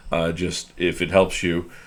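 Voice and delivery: ordinary talk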